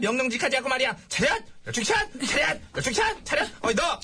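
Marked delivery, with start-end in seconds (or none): 1.1-3.5 high-pitched drill sergeant calls; drill commands. Acted. Boss drilling his team to attention, high military commands. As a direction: fire off each command as a sharp call.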